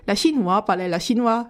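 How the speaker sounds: ordinary delivery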